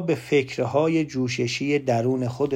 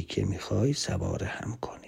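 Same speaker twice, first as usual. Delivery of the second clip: whisper; 4 semitones lower, 10 dB quieter than usual. Real, not acted. Speaker to audience, closing the metaphor in a whisper. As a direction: drop into a soft whisper to close the thought.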